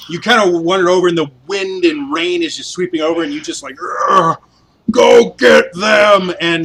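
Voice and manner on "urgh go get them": slow loud heroic shout; manly battle cry. Pretend. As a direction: bellow it like a war hero. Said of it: "would be really manly and heroic".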